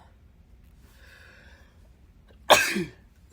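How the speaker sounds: loud hacking coughs; coughing fit. Real, not acted. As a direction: cough hard and long. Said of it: "excuse me".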